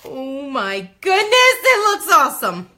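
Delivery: loud, high, drawn-out squeal; thrilled. Real, not acted. Crafter revealing the stencilled design, thrilled squeal. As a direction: stretch it out in a rising, delighted shout.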